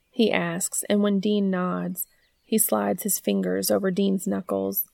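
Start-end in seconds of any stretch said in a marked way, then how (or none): none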